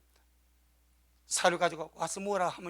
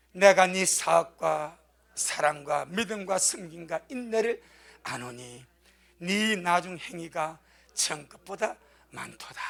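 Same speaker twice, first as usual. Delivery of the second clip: scripture reading; 4 semitones lower, slower than usual. Acted. Reads scripture verse slowly.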